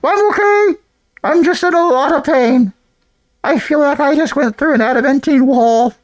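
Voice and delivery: high, strained voice of a hurt creature; muffled pained voice. Acted. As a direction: groan it out from behind a wall. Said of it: "You hear a muffled voice from inside the fortress go".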